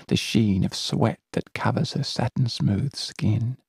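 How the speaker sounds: ordinary delivery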